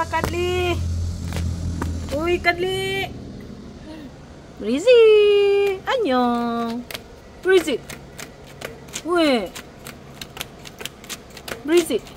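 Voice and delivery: high sing-song calling of pet names. Real, not acted. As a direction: call the pets high and bright. Calling pets by name.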